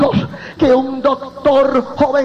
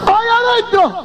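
alarmed cry; 9 semitones higher than usual. Retold or imitated. Preacher voicing the doctor at the morgue door, high alarmed cry. Retold doctor cries out again.

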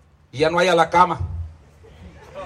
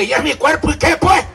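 mock defiant churchgoer, voiced higher; defiant church member; 8 semitones higher than usual. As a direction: toss it off like a stubborn believer. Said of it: "Ahí habla así".

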